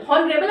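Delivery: honorable with an H heard at the start, "on rebel" as "hon-rebel"; H sounded. Shown as a demonstration. Teacher showing students how not to read it, "on rebel" with H sounded. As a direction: say "on rebel" with a breathed H in front. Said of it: "आप नहीं पढ़ती".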